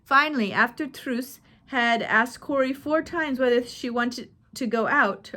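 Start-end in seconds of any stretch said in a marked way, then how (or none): none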